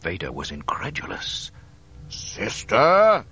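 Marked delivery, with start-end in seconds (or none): 2.1-3.2 loud, high bellow of disbelief. A narrator's reading. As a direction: bellow the word as an incredulous question. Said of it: "he bellowed".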